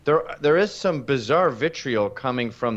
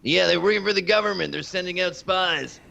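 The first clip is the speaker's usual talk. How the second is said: higher, a light hint of a conspiracy ranter; Alex Jones impression; 4 semitones higher than usual. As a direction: lightly imitate a paranoid radio host. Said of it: "Alex Jones".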